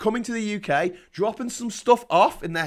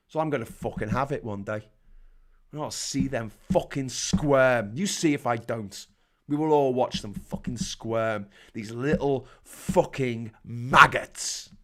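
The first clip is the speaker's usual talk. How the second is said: low, seething threat; low-pitched menace, savouring each word; menacing vow; 9 semitones lower, 5 dB quieter than usual. Real, not acted. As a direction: promise revenge, low and slowing down. Vowing revenge on politicians.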